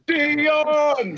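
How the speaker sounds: high, loud mock call of a name. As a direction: call his name in a put-on voice. Retelling her calling out.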